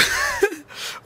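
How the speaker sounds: very high squeal of laughter; laughing. Real, not acted. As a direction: burst into high giggles. Moda gülüyor. (çok tiz kahkaha)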